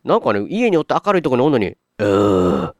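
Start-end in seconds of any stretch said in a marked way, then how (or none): 2.0-2.7 imitating zombie groan. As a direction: groan it like a lazy zombie, half-joking. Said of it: "ってゾンビの声が聞こえるんですよ".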